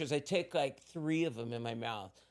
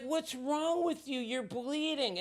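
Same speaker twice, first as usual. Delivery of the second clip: put-on high, slow alarmed girlfriend; mock concern, drawn out; worried girlfriend; 11 semitones higher, slower than usual. Girlfriend seeing him bleed, mock worried.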